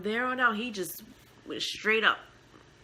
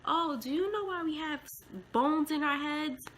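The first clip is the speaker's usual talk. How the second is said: a high, lightly voiced child asking a question; mother voicing her son's question to his speech therapist, a high childlike tone; imitating her little boy; 8 semitones higher than usual. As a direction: ask it like a curious five-year-old. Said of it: "John John was saying".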